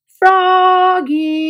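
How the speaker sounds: high called-out name; high calling yell. A narrator's reading. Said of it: "yelled his friend Max".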